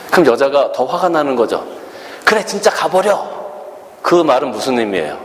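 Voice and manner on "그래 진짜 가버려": high mock angry dismissal; mock furious girlfriend. Angrier girlfriend telling him to leave, mock fury. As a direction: play her fed up. Woman more angry in quarrel.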